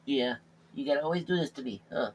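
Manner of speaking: soft cooing voice for the cat; soft pet talk. Real, not acted. Talking to his cat, soft cooing. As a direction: coo softly at the cat.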